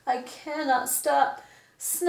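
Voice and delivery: ordinary delivery, nothing marked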